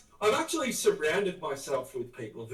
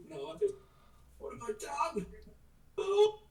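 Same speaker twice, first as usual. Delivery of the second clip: much higher, hammed-up wail of dismay; mock anguish; 3 dB quieter than usual. Mourning a lost collectible, hammed-up high wail. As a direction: play it as a theatrical cry of loss.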